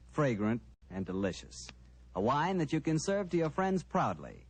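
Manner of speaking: normal talking voice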